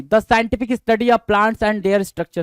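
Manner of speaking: reading the definition out very slowly; drawn out, each word separate; slow dictation. Shown as a demonstration. (बहुत धीमी आवाज़ में परिभाषा पढ़ना)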